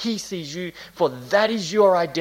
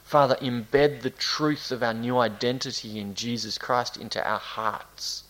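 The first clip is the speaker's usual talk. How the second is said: softer, lower prayer voice; 5 semitones lower, 4 dB quieter than usual. Real, not acted. Pastor thanking God with the congregation, prayerful. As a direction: pray gently in measured phrases.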